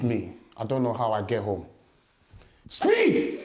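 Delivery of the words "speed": excited shout. Real, not acted. Exclaims running away.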